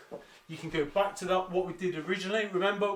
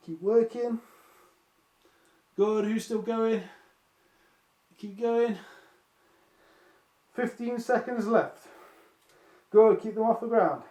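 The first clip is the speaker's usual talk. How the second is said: higher, effortful coaching voice; straining through exercise; 3 semitones higher, 4 dB louder than usual. Real, not acted. Coach urging class to keep tins off the ground, straining. Coaching while holding the weights overhead.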